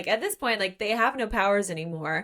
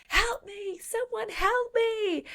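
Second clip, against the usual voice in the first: high, slow, pleading cry for help; desperate plea; much slower than usual. Retold or imitated. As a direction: beg for help as if she's dying. Jax pleading over Riley's body.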